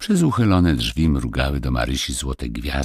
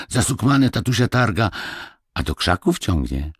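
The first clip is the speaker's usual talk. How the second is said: put-on higher, lively peasant boy's voice; eager village boy; 7 semitones higher than usual. Boy explains to Marysia where they found her, eagerly. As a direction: tell it like an excited country lad.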